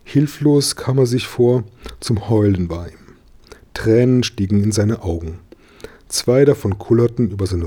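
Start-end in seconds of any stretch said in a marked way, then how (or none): none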